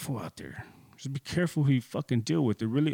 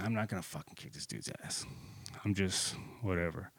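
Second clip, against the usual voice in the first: softly talking himself out of a fight; quiet self-calming mutter under his breath; 4 semitones lower, 10 dB quieter than usual. Retold or imitated. Angry man soothing himself before a fight, low and soft. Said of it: "And I was calming myself down".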